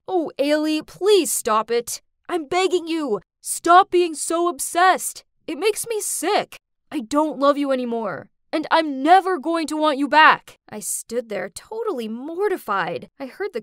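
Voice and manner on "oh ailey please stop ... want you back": raised voice, exasperated and emphatic; angry yelling. A narrator's reading. Boyfriend rejects his obsessed ex before a crowd, angry yelling. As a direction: yell it, driving each plea home. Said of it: "Logan yelled at me".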